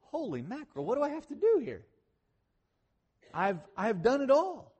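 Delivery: mock-exasperated divine voice; exasperated God. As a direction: play God throwing up his hands at his people. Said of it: "God looks in and he's like".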